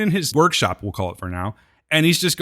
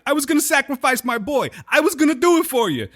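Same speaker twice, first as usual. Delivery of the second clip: much higher, pleading Brooklyn Abraham; New York accent; 12 semitones higher than usual. Retold or imitated. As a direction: whine it up to God like a New Yorker. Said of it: "Abe tells God".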